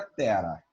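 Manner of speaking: "tera" with a light tapped single R. Shown as a demonstration. Teacher demonstrating how "tera" sounds with one R. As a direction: tap the R in "tera" once. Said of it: "se fosse um Rzó".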